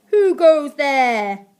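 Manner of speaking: loud, slow, drawn-out challenge; demanding. A narrator's reading. Aunt challenges intruders in her castle, demanding loudly. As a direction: boom it at the intruders. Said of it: "demanded a voice".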